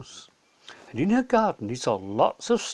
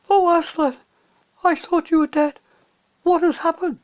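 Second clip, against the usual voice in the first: put-on much higher, louder astonished child; amazed girl; 7 dB louder than usual. Lucy finds Aslan alive, high and astonished. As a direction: gasp it out in wonder.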